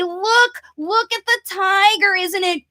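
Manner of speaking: high, eager look-at-this; excited pointing. Shown as a demonstration. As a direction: draw the child in with delight. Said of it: "And then I'm gonna say".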